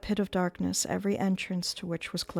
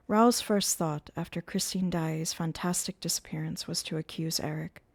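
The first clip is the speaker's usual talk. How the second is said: usual speaking voice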